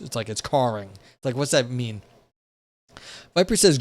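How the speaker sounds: ordinary talk, nothing marked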